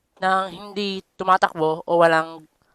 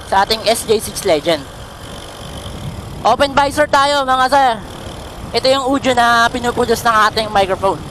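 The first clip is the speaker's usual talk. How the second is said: while riding. Real, not acted. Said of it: "subukan nating tumakbo ng naka-open visor".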